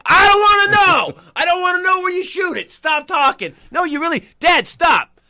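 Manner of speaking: high, loud shout begging him to stop; panicked shout. Retold or imitated. Son yelling at his dad in public to stop. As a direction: yell to make him stop talking.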